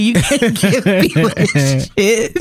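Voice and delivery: words stretched out by real laughter; slow down as the giggles break the sentence; laughing too hard. Real, not acted. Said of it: "I'm not gonna be able to get through it".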